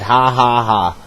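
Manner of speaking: dry mock ha ha ha, spoken not laughed; a flat, sarcastic ha ha ha; sarcastic fake laugh. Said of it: "That's real funny on your part".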